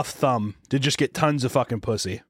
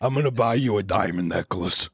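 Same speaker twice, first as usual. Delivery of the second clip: Vin Diesel creep; 6 semitones lower than usual. Retold or imitated. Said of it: "he's like".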